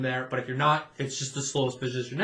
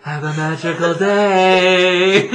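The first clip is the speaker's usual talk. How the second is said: 1 slow mock greeting; 3 semitones higher, 9 dB louder, much slower than usual. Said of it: "they're like".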